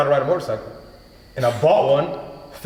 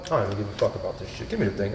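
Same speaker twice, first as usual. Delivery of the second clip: indifferent mutter; 8 semitones lower, 8 dB quieter, much faster than usual. Retold or imitated. He shrugs off the new audition.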